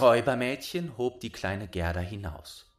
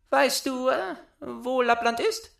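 put-on much higher, loud child voice; bossy little robber girl; 5 dB louder than usual. A narrator's reading. Robber girl claiming Gerda, high bossy child voice. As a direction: demand it like a spoiled robber girl.

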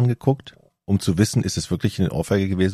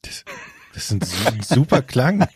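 laughing; 4 semitones higher, slower than usual. Real, not acted. Laughs at the sneeze sound.

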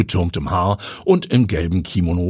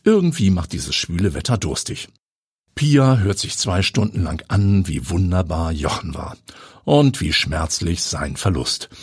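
ordinary delivery

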